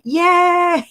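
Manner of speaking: high, loud cheering yay. Pretend. Streamer predicting the chat's cheer, loud and high. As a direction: shout it out like a happy crowd. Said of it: "we're all gonna say".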